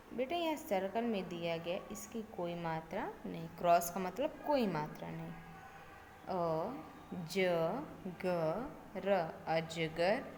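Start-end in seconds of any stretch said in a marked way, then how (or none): 6.3-10.2 अ, ज, ग, र spelled out slowly, one sound at a time, then अजगर read whole; spelling out sounds. Shown as a demonstration. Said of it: "अ का उच्चारण करते हैं तो एकदम नॉर्मल".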